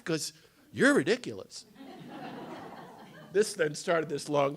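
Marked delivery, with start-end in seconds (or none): 1.7-2.8 short airy laugh; soft unvoiced chuckle; breathy laugh. Real, not acted. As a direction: let out a quiet laugh. Laughing at the memory.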